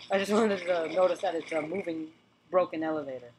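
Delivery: ordinary delivery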